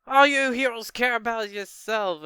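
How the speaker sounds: high, loud mock whine of blame; accusing outburst. Retelling a character's angry accusation.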